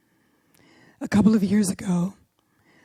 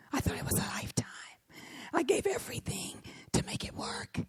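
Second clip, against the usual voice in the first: very soft, near-whispered realization; thinking aloud, barely voiced; hushed inner reflection; 9 semitones higher, 10 dB quieter, faster than usual. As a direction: let it fall almost to a whisper. Inner reflection on a dying commitment.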